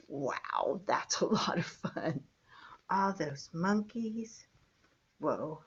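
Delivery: soft awed whisper. Real, not acted. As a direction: breathe it in wonder. Awed aside over the book.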